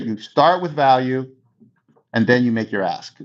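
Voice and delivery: ordinary delivery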